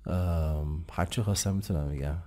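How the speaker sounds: ordinary talk, nothing marked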